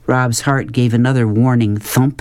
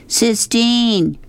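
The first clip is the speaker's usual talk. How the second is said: much higher, drawn-out call; restrained mock scream; 12 semitones higher than usual. A narrator's reading. Rob screaming for Sistine on the highway, high restrained call. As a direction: scream the name without real volume. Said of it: "he screamed".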